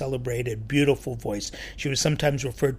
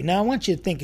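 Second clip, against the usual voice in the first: usual speaking voice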